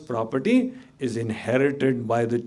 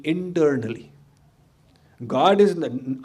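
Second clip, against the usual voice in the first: usual speaking voice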